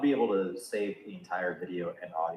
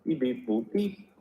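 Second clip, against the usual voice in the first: beep noises; 4 semitones higher, slower than usual. Pretend. Sound check noises.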